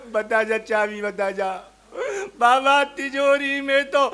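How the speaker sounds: hammed-up widow keening over the dead; mock wailing lament. The widow wails for the safe key over her dead husband. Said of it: "आप देखें कैसे रो रही है".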